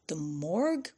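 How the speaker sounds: soft, shaken The morgue? rising; The morgue? asked quietly in disbelief; stunned echo. A narrator's reading. Larry hearing his friend is dead, stunned and hushed.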